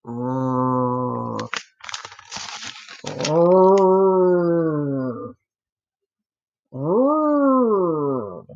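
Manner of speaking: drawn-out snarl; snarling growl. A narrator's reading. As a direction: growl as the cat creeps near. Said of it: "That's the scariest snarl I ever heard".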